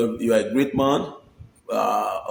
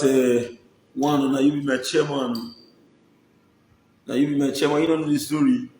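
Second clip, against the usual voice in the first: ordinary delivery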